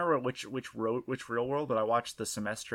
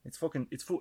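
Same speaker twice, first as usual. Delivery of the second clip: mock Aussie accent; 2 semitones higher than usual. Host building up a fake Aussie slang line, mock Australian accent. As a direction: chase the phrase in a jokey Aussie accent. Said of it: "That's a really convincing accent".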